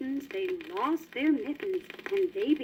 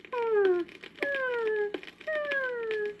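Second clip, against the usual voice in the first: kitten purrs; 9 semitones higher, much slower than usual. Acted. Happy kittens.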